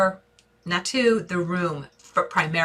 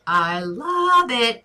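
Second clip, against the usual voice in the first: drawn-out, higher and louder gush; 5 semitones higher, 5 dB louder, much slower than usual. Real, not acted. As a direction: stretch it out slowly with real enthusiasm. Enthusiastic verdict on viewer's idea.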